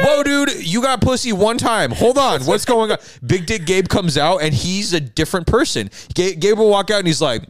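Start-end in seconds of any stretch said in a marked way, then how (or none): 0.1-3.0 high, startled mock alarm; mock shock. Friend reacting to bragging Gabe, high mock shock. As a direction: pitch up in comic disbelief. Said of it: "And I'm like".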